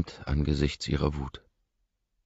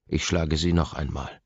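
ordinary delivery, nothing marked

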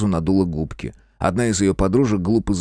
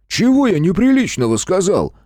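loud, high-pitched protest; bellowed outraged question; indignant roar; 11 semitones higher, 5 dB louder than usual. A narrator's reading. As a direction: roar it out, wronged. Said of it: "взревел Геныч".

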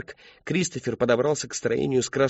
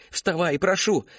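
higher, begging; pleading; 6 semitones higher, slower than usual. Acted. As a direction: plead with him to rise. Begging him to get up.

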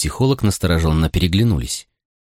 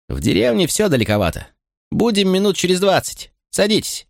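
put-on high, eager young officer; young man voice; 12 semitones higher than usual. A narrator's reading. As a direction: voice a boyish, keen policeman. Young policeman voiced by narrator.

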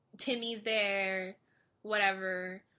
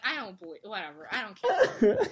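laughing dismissal; 8 dB louder than usual. Retold or imitated. Own dismissive reaction.

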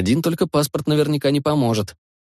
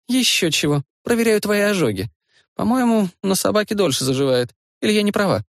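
teasing woman, lightly voiced higher; a hint of playful girlfriend; lightly voiced young woman; 9 semitones higher than usual. Narrator voices Lyudmila checking burns.

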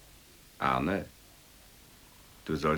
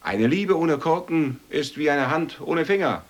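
mock-grand declaiming; 11 semitones higher, 10 dB louder, slower than usual. Mock-grand proverb recital.

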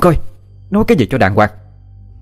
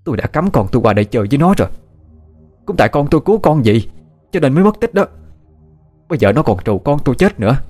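angry aunt, higher pitch; furious tirade with raised pitch; growled accusation; 6 semitones higher, faster than usual. A narrator's reading. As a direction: accuse bitterly, pitch climbing. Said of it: "Dì Huệ gằn lên từng chữ".

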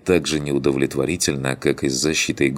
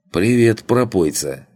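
loud mocking greeting; 7 semitones higher, 4 dB louder than usual. Guard greets drunk loudly.

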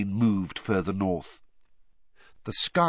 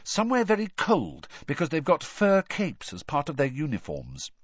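raised, speculative tone; thoughtful; 7 semitones higher than usual. A narrator's reading. Girl deducing location from fur capes, thoughtful. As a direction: work it out aloud. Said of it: "said Hermione thoughtfully".

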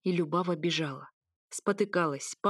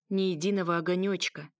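usual speaking voice

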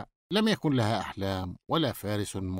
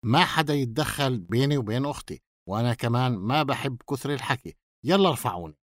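ordinary delivery, nothing marked

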